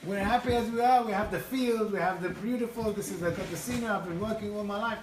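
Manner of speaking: loud, high mock protest, easing off; indignant contentment. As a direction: protest as the wife who wants no change. Wife's indignant refusal, retold.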